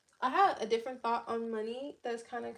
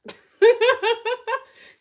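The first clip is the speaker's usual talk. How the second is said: loud burst of laughter; 12 semitones higher, 18 dB louder than usual. Real, not acted. After her joke about Jesus.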